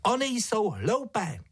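put-on high, slow little-girl voice; Ája scolding. A narrator's reading. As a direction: explain firmly as a small girl. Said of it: "kázla mu".

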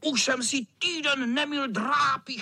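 high boast breaking into cackles; high wicked-cat squeal; cackling tomcat. Acted. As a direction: brag gleefully, then cackle. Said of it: "Chechtal se kocour".

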